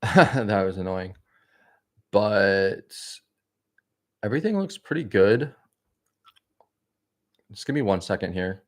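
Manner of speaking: speaking with gum in mouth; chewing gum. Real, not acted. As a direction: chew gum between words. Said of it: "Let me get rid of this gum".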